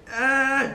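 high-pitched wimpy squat cry; mock whiny groan. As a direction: imitate a wimp straining under weight. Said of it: "like a little bitch".

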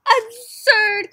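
high, loud indignant cry; teacher losing patience, outraged; angry cry. Acted. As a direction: burst out in outrage. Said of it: "cried their teacher".

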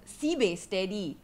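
slow Singlish "Sibe steady", drawn out. Shown as a demonstration. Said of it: "that's how we say it".

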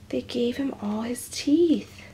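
soft, slow whisper; hushed awe. Real, not acted. Whispered aside to viewers.

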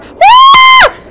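very high loud scream of fright; piercing shriek of surprise; terrified scream. Real, not acted. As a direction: scream at the sudden monster. Creature appears.

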